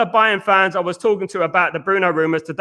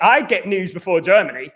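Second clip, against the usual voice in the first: normal talking voice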